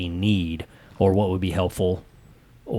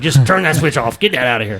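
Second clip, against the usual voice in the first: 1 mock snapping; 5 semitones higher, 5 dB louder than usual.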